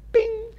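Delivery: sharp, high cartoon bing; high bing sound effect. God confusing the languages, a high magic bing. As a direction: pop it out high like a magic chime. God's sound as tongues change.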